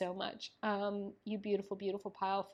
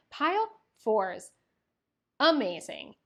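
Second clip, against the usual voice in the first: loud, slow, elated call-out; a loud, slowed, joyful shout-out to her viewers; excited cheer; 3 semitones higher, 8 dB louder, slower than usual. Real, not acted. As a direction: hail the group with delight.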